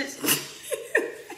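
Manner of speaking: a high, airy burst of laughter; laughing at herself mid-sentence; breathless laugh. Real, not acted. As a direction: let a breathy giggle escape. Laughs after saying she's upset.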